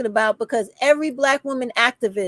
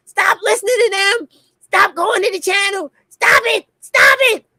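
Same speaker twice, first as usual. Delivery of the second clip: higher, louder pleading shout; retold screaming; 7 semitones higher, 4 dB louder than usual. Retelling her past warnings to viewers, building shout. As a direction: yell it building to the final stop it. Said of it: "I was on here screaming".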